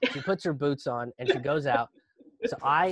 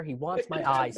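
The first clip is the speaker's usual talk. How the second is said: fast, low, frightened mutter; quick panicked line; 3 semitones lower than usual. Retold or imitated. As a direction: say it quickly, scared. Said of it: "And I'm just losing it".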